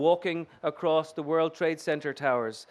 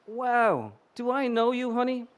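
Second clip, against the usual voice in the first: higher, brassy streetwise voice; Bronx hooker; 6 semitones higher than usual. Acted. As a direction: play a cheeky Bronx hooker, pitched up. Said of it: "in the voice of a thirty-eight-year-old hooker".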